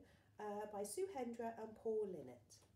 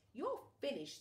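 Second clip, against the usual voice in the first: mild villain shriek; 6 semitones higher, 4 dB louder than usual. Acted. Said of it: "he shrieked".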